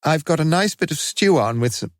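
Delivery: a tired mother trying to please; mother's voice. A narrator's reading. Mother offers her son stew, lightly voiced and hopeful.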